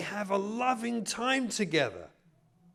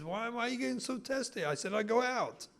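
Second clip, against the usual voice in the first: higher-pitched, hurried defensive husband; defensive irritation; 6 semitones higher, faster than usual. Acted. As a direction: protest as if unfairly accused. Acted testy quarrel, husband.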